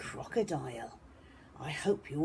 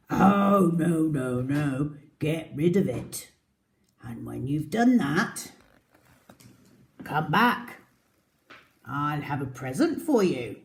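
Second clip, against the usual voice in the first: crocodile voice; 5 dB louder than usual. Acted. Crocodile answers mouse.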